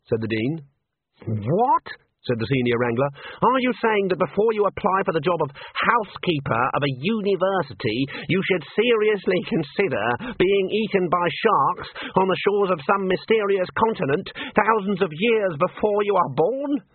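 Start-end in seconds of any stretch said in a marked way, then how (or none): 1.3-1.8 steeply rising, incredulous what; outraged disbelief. A narrator's reading. Senior wrangler hears dean dismiss the risk, outraged disbelief. 3.4-16.8 higher-pitched, mounting indignant question; indignant incredulity. A narrator's reading. Senior wrangler challenges dean over housekeeper's job, indignant incredulity.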